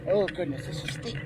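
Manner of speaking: ordinary talk, nothing marked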